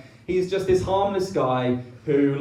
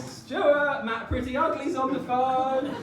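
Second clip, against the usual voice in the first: sister calling upstairs; 10 semitones higher, 3 dB quieter, slower than usual. Retold or imitated. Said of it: "calling up the stairs".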